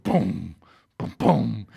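low, dropping booms like a pulse; pulsing "boom... boom" sound effect; throbbing boom. Retold or imitated. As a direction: imitate a toe throbbing in pain. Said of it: "my toe was going boom".

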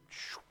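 soft voiceless whoosh of a boat rushing off; a quick breathy "shoo" for something shooting past; imitated whoosh.

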